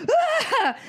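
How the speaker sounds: very high, sharp scream of shock; retold scream. Mom retelling the bite, high piercing shriek. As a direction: shriek as if suddenly bitten. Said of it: "I screamed".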